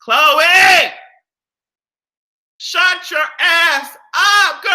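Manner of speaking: high loud slow telling off; shouting. Real, not acted. Yelling at Khloe to shut up, loud and high. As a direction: call her out sharply.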